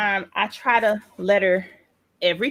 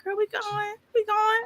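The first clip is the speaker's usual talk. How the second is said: mock stalling; 3 dB quieter than usual. Self quoted stalling coworker.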